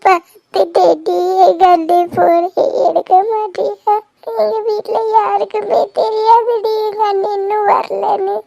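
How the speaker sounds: tearful, halting worry; crying. Acted. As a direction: plead your fear through tears. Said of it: "நீ அழுகாத". (அழுதுகொண்டே பதற்றத்துடன்)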